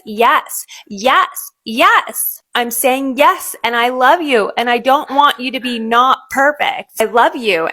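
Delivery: ordinary talk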